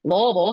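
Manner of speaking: "Louisville" mumbled as LOO-uh-vul; marble-mouthed "Louisville", middle vowel swallowed; local pronunciation. Shown as a demonstration. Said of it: "like you have a marble in your mouth".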